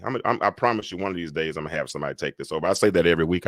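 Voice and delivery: ordinary talk, nothing marked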